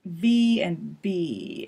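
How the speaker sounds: "V" and "B" said slowly and clearly apart; careful consonant demo. Shown as a demonstration. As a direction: contrast V and B slowly. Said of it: "We looked at the b".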